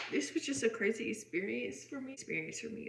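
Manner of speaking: teary-eyed, choked up; holding back tears; after crying. Real, not acted. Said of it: "I get a little teary-eyed talking about the story".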